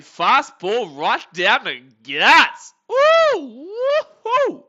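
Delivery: loud, high whoops of delight; excited cheer. Real, not acted. Streamer cheering his team's fastball, loud and high. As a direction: cheer the strike like a fan. Live baseball stream, strike thrown.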